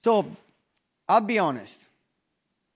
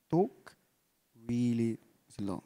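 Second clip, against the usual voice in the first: very slow, lower and softer, long pauses between words; really slow; 6 semitones lower, 6 dB quieter, slower than usual. Shown as a demonstration. Coach showing the calm pace that wins trust, very slow. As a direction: let each word land slowly and quietly.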